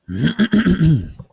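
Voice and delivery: loud theatrical throat clear. Real, not acted. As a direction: clear your throat grandly before reading. Throat clear before reading.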